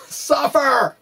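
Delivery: high, laughing cry of mock suffering; laughing mock protest. Author laughing at his own readers' complaint, mock pained. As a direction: laugh out the word in pretend agony.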